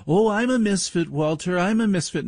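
high, mock-earnest whine; mocking whiny wannabe. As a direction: parody a caller who thinks he's special. Mocking a would-be misfit listener.

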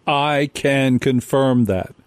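slow, deliberate repeat of a quote; quoted line, weighed word by word; emphatic quote. Retold or imitated. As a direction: spell it out slowly for the listeners.

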